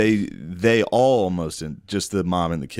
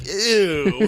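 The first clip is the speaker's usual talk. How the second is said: mock ew; 10 semitones higher than usual. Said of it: "I heard you were like".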